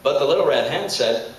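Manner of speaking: ordinary delivery, nothing marked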